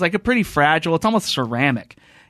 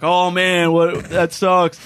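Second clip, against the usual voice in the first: louder, higher, drawn-out fake sympathy; mock peanut gallery; 4 semitones higher, 4 dB louder, much slower than usual. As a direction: imitate onlookers chiming in with a slow groan. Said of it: "peanut gallery was like".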